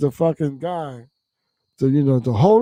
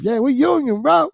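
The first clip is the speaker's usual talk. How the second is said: calling back; 12 semitones higher than usual. Retold or imitated. Other crew calling back.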